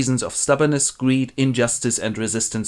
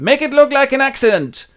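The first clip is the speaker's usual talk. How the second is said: high, loud shouted order; shouting villain; 7 dB louder than usual. Acted. Edmund shouts an order after his henchman. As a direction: call the order after him. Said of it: "he shouts after his henchperson".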